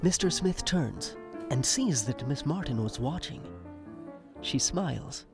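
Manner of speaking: ordinary talk, nothing marked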